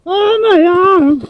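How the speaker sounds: slow, high, hammed-up cry of pain; wounded soldier gasping. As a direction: wail it like a hit soldier. His soldier just got hit in-game.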